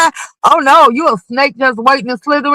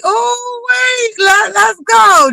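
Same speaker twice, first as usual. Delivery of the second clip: excited squeal; 9 semitones higher, much slower than usual. Retold or imitated. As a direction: squeal like the gossip just got juicy.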